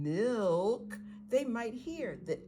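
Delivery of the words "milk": "milk" drawn out sound by sound; slow motion. Shown as a demonstration. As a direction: stretch "milk" so each letter sound is heard. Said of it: "Talk in slow motion".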